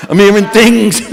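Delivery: a bolder take on the De Niro mumble; De Niro again. Pretend. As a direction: hit it harder, stress on things. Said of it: "I turn into Robert De Niro".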